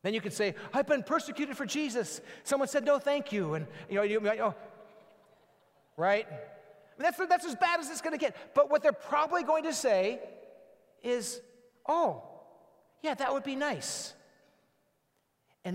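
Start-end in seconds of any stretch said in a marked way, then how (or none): 0.7-3.5 mock martyrdom. Mock self-pity after polite refusal. 11.9-14.1 pleased acceptance. Retold or imitated. Imagined friend accepting prayer.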